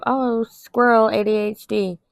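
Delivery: high, slow mock voice of a dismissive friend; mocking dismissive person. Mimicking people who joke about ADHD, slow mocking voice. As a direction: sneer it, stretching "squirrel". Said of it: "sit there and go".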